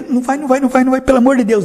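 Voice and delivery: high, fast, loud begging; frantic pleading. Acted. God begs Eve, a frantic high plea. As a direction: plead desperately, pelo amor de Deus.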